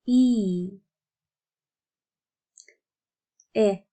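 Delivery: "ia", "ea", "e" sounded one by one, some short, some long; isolated vowel demo. Shown as a demonstration. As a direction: model each "e" sound alone with long pauses between. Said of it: "how to pronounce each one of the vowels".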